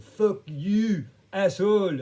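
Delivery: high, loud imitated yell; angry swearing. Cyclist cursing him in English, high angry shout. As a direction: hurl it out, stretching the last words. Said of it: "whips out his, uh, glossary of swear words".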